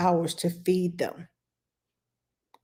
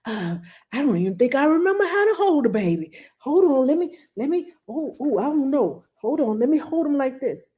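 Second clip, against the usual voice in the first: put-on fast, higher, flustered old man fumbling; lightly voiced nervous grandpa, rushed and higher; nervous grandfather; 6 semitones higher than usual. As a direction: play a jittery granddad unsure how to hold a baby. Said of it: "had nervous grandfathers come in the room".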